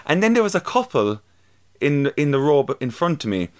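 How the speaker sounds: ordinary talk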